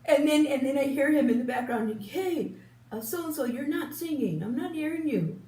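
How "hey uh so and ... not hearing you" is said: grandson calling out. Retold or imitated. Grandson scolding a friend.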